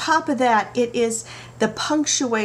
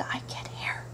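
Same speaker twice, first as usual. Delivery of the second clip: whispered to herself, bewildered; 12 dB quieter than usual. Retold or imitated. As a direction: breathe it out like a private thought. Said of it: "where I'm like".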